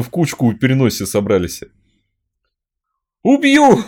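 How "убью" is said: very high, loud savage scream; wild yell. Acted. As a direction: scream it like a threat. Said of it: "Ору дико".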